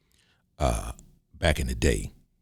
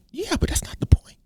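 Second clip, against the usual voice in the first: high, squeaky mock protest; defensive protest; 3 dB quieter than usual. Short man dodges an awkward question, defensive protest. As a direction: whine back defensively in a much higher voice. Whiny defensive reply, acted.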